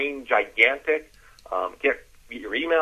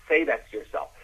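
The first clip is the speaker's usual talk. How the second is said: ordinary delivery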